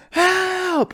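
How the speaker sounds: high, loud mock yell for help; cry for help. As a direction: call out for help like you're trapped. Kids locked in trunk calling out.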